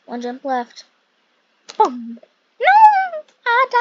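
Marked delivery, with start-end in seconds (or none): 2.6-3.8 high, loud dismayed yelp; real shock at dying; dismayed cry. Real, not acted. Dying in the game.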